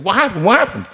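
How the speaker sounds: high, agitated backstage chatter; flustered, pitched well up, tumbling out; twittering alarm. Retold or imitated. Stage manager to singer who left character, flustered and high. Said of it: "everybody was twittering".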